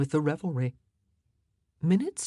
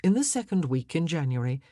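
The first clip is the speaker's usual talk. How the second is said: ordinary delivery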